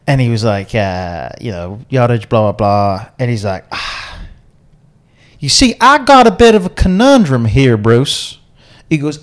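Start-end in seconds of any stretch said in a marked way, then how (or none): 3.7-8.4 put-on southern American accent. Golfer explains his conundrum to Bruce, in a hammed-up Southern American accent. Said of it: "is [name]'s southern American accent good or bad".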